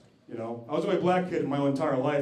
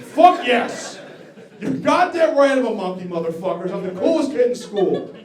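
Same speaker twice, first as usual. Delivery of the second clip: loud, high-pitched triumphant shout; triumphant boast; 7 semitones higher, 11 dB louder than usual. Acted. Fourth grader embracing being called a monkey, exultant shouting. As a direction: crow it at full volume.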